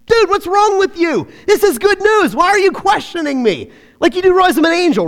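mock-annoyed, can you believe this guy; exasperated angel. Angel voiced as exasperated modern guy.

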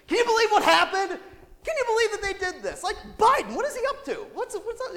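high, loud, agitated retelling; worked-up rant. Retold or imitated. Pastor replaying his rant over Fox News, excited and loud. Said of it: "I'm all worked up. My heart, heart's racing".

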